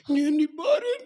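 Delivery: high-pitched wife's insistence; insistent wife. Acted. Wife insists she can be trusted, high voice. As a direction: stake your claim, high.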